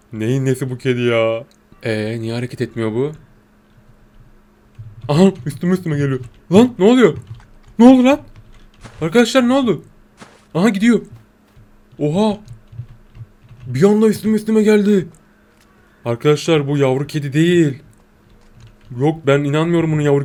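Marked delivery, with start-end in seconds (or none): 5.1-15.1 loud, much higher startled shouts. Real, not acted. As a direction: jump back and shout in shock. Cat suddenly rushes at him.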